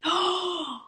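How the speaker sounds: sharp, delighted intake of breath; mock-thrilled high gasp; excited gasp. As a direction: gasp as if wishing for a treat. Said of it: "so excited about all the little things".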